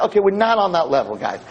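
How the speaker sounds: ordinary talk, nothing marked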